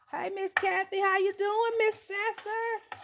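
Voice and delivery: high and loud, calling out to a friend; drawn-out, delighted hello; excited greeting. Real, not acted. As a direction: greet her like you're thrilled she showed up. Greeting a viewer on live.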